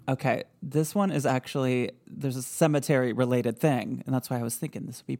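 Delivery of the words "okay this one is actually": usual speaking voice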